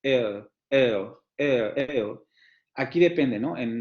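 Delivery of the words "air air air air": "air" said as an English diphthong ending in a schwa. Shown as a demonstration. Teacher modelling the word for students, careful English pronunciation. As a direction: model the vowel in "air" slowly for the class. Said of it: "como en air".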